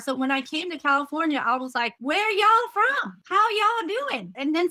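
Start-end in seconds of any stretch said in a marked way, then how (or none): 2.0-4.3 drawn-out, much higher Texan twang on y'all; slow, high imitation of a Texas drawl; Texan accent. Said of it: "Why you always say y'all?".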